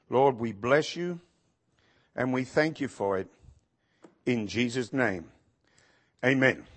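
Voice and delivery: low, hushed praying voice; prayer. Real, not acted. Pastor thanks the Lord before the reading, low soft prayer.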